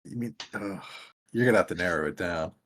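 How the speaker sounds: normal talking voice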